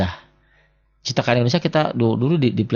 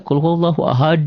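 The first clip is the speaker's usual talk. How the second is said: high, louder chanted "Qul huwallahu ahad"; qari-style recitation; 7 semitones higher, 4 dB louder than usual. Shown as a demonstration. Teacher showing what qaris rarely do, high qari-style recitation. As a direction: lift it like a competition reciter, bouncing the final d. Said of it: "Nggak ada yang qori-qori".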